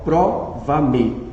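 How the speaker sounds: teacher quietly sounding out "Prova-me", first syllable stressed. Shown as a demonstration. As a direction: isolate the "pro" in "Prova-me". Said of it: "No pró".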